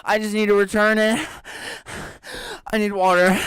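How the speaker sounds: very high, loud mock hysteria; melodramatic customer. Imitating the customer demanding water, hammed-up high panic. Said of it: "she's like".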